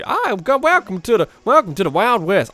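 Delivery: put-on high, loud Wild West old-timer; faltering stab at a prospector voice; old prospector attempt. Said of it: "Don't even ask me to do the".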